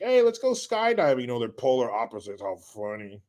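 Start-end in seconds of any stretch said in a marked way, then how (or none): 0.0-1.2 higher, reckless party-guy voice; eccentric buddy. Retold or imitated. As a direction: pitch it up like a thrill-seeker. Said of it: "he's the more eccentric".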